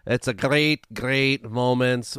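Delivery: slow, hammed-up attempt at a Scottish accent; clumsy, drawn-out stab at a Scottish host voice; failed Scottish accent. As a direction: try a Scottish accent and keep losing it. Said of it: "like, a Scottish".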